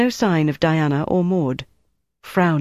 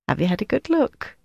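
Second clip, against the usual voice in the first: a touch of cheeky sauce, pitched up; saucy; 7 semitones higher than usual. Acted. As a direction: tease her mockingly. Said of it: "she said saucily".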